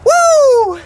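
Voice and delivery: high, loud cheer of triumph; triumphant whoop after hauling the turtle out; excited whoop. Real, not acted. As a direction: whoop like you've landed a giant. Celebrating landing a big turtle.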